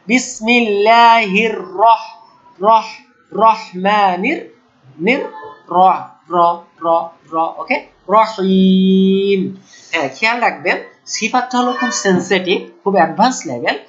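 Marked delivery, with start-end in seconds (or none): 0.1-9.4 slow recited বিসমিল্লাহির রহমানির রহিম; quran recitation. Shown as a demonstration. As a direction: recite slowly, each র tapped once. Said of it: "recitation demo".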